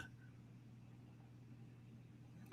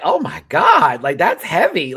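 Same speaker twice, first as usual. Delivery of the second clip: louder, higher, stunned; shocked; 4 semitones higher, 8 dB louder, slower than usual. Retold or imitated. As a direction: gasp at what you just saw. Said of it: "'cause I was like".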